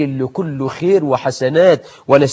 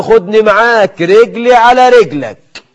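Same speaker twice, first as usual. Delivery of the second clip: put-on old woman pleading; 6 semitones higher, 5 dB louder than usual. Old woman begging to tag along.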